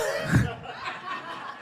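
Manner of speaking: high, soft laugh; real laugh. Real, not acted. Host laughing while trying to wrap up the show, amused. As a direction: crack up in a high-pitched giggle.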